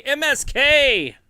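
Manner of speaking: high, loud, slow win call; excited call. Real, not acted. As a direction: stretch the winner's name out. Calling the win.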